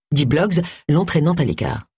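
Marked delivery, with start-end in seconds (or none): none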